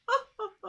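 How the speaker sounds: high, loud fake sob; hammed-up tortoise sob; mock crying wail. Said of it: "Tutu began to cry again".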